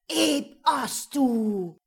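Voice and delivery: slow, loud, echoing spell; incantation. A narrator's reading. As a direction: intone the spell into the night. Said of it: "vom Echo tief in die Nacht getragen".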